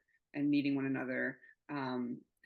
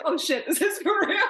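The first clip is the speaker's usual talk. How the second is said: high, loud squeal of disbelief; shrieked, laughing astonishment; retold shock; 14 semitones higher, 10 dB louder than usual. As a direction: re-enact stunned delight, half laughing. Acting out seeing the congratulation texts.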